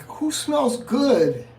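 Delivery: high, slow mocking question; mock woman's question. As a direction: imitate her drawn-out ask.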